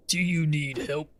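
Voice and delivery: mock NPC voice. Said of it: "I don't know why Arna sounds like that".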